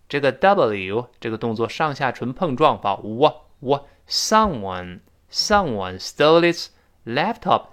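ordinary talk, nothing marked

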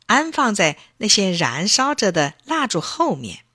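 plain reading voice

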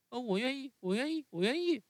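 很高的童声，越说越快; 像孩子连声保证那样急急地说; 木偶急切答应. Acted. Narrator voices Pinocchio. (用很高的童声连连答应)

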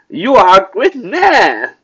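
slow, high, loud mock praise; sarcastic. Shown as a demonstration. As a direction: drag out the fake admiration. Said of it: "I asked sarcastically". (拖长音，讽刺地夸)

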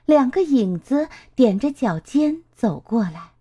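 ordinary delivery, nothing marked

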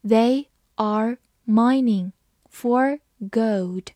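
slow and broken into chunks; slow reading. Shown as a demonstration. Teacher reading slowly to the class. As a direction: read it slowly, word by word. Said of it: "好，慢慢来读。".

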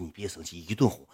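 very soft, low placating; retold soothing. Streamer pleading with an offended supporter, soft low placating.

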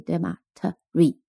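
normal talking voice

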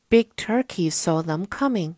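ordinary delivery

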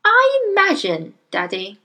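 high little voice, dropping on the last word; quiet Matilda. Acted. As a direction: offer it demurely to Daddy. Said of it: "Matilda said quietly".

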